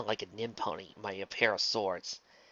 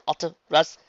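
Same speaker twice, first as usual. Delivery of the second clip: "Auto brush" louder and higher, questioning; alarmed question; 6 semitones higher, 9 dB louder than usual. Acted. Luna hearing of the auto brush, alarmed.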